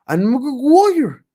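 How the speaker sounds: high and loud mock Irish accent, drawn out; a hammed-up Irish accent, badly faked; attempted Irish accent. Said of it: "don't expect me to do an Irish accent".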